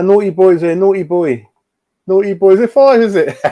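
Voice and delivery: high, loud playful teasing; loud mock-scolding tease. Real, not acted. Teasing a chatter's arrival.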